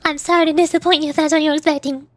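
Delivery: normal talking voice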